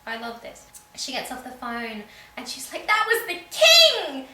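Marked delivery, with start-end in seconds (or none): 2.8-4.3 much higher, louder and slow, hammed-up joy; excited announcement. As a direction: shout it out like big news, drawn out. Claire announces the king called.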